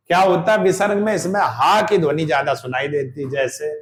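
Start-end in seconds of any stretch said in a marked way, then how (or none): none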